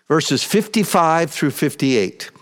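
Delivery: normal talking voice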